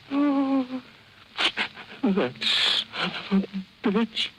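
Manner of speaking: hammed-up weepy wail, the last words a high unvoiced sob; mock crying. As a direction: wail the curse through fake tears. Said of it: "she kept saying to herself".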